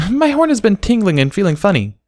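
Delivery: a shy little filly confessing; little foal voice. A narrator's reading.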